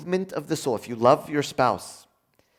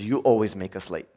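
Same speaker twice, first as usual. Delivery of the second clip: accusing; 7 semitones lower, 4 dB quieter than usual. Shown as a demonstration.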